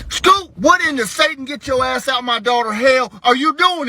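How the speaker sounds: high-pitched, sputtering outrage; horrified dad catching the kid; appalled scolding. Retold or imitated. Catching child in kitchen.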